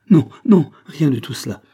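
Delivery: high, loud burst of denial; agitated retraction. A narrator's reading. Mother correcting herself to her child, agitated. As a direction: cut yourself off with sudden emotion.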